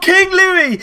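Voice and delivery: King Louis screamed high and loud; ecstatic scream. Real, not acted. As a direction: roar his name in triumph. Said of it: "we're way too excited".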